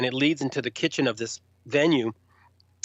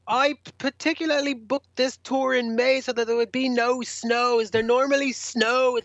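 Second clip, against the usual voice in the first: higher, put-on grumbling rocker voice; 10 semitones higher than usual. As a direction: whine about the snow like a cranky star. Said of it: "He goes".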